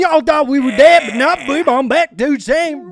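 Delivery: loud, high cartoonish hype voice; high goofy voice. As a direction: squeal it like a hyped-up goof. Comeback announcement in silly voice.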